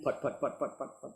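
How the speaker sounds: rhythmic sputtering car noise; putt-putt engine imitation. Storyteller imitating the reporter's approaching VW, rhythmic putt-putt. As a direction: imitate a little car puttering up.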